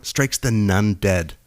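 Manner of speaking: ordinary talk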